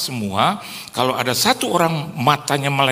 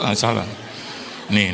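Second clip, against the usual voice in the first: ordinary talk, nothing marked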